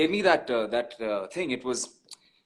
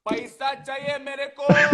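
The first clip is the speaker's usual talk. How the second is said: higher-pitched mock plea to be paid; mock demand; 7 semitones higher, 5 dB louder than usual. Voice actor calling out a client who never paid, mock demand. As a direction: playfully demand what is owed.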